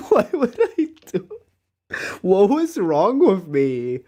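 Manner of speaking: embarrassed laugh at his younger self. Real, not acted. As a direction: giggle out the question. Laughing at own past behavior.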